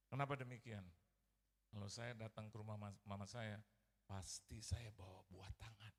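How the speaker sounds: ordinary delivery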